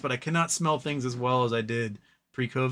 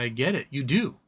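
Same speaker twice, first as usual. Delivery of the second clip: ordinary talk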